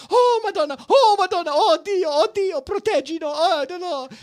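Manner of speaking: very high, loud Italian wailing; dramatic Italian lady. Retold or imitated. Panicked neighbor wails to them about the storm, high and loud. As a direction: wail it like an over-the-top Italian drama lady. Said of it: "So, so Italian".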